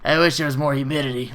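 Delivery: mocking put-on whinger's voice; caricatured petty complaint; complaining lady. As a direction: imitate a customer griping over nothing. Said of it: "complaining for, like, a good straight ten minutes".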